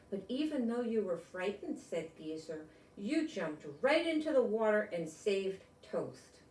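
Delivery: bright, storybook read-aloud voice; reading to children. Acted. Grown-up reading a picture book to kids, storybook voice. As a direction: read it like bedtime story time.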